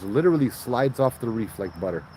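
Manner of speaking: normal talking voice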